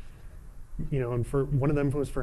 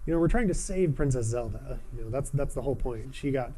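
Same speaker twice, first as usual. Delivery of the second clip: ordinary delivery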